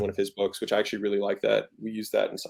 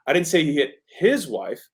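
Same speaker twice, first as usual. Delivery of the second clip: "his" set apart and lifted; emphasis falls on "his"; stress on HIS; 4 semitones higher than usual. Shown as a demonstration. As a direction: lean hard on the word "his". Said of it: "The emphasis is".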